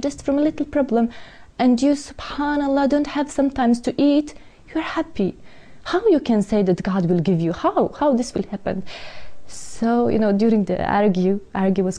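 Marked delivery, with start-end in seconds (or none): 0.0-8.8 desperate pleading. Retold or imitated. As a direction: pitch climbing higher in disbelief, pleading. Retold plea to friend.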